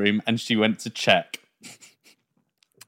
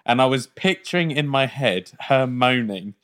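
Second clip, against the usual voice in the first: normal talking voice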